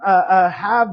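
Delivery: earnest, halting spoken prayer; closing prayer. Acted. Pastor closing the service in prayer for the congregation, earnest. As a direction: pray it sincerely, pausing between phrases.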